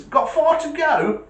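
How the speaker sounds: high, slow, kindly question; friendly driver. Retold or imitated. Driver asking the old man about his journey, high and kindly. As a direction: ask it like a helpful stranger. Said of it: "He goes".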